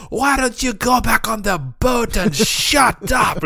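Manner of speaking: high, stretched-out bad Scottish holler; mock Scottish screaming. Scottish man turning on his partner, high mock Scottish shouting. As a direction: ham up an angry Scottish yell. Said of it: "he's just screaming in the Scottish accent".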